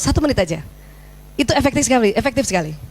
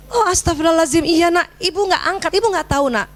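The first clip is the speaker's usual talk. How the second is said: acted mother's apology, pitch raised then easing; higher-pitched, contrite exclamation; mildly flustered apology; 4 semitones higher than usual. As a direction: realize your mistake and apologise to your child. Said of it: "Ibu minta maaf ya".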